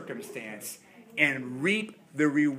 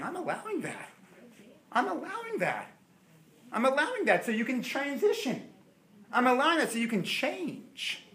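a hushed, higher voice of God; God's voice; 8 semitones higher than usual. Acted. God speaking gently to a stubborn believer, hushed.

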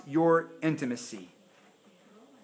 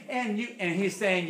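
usual speaking voice

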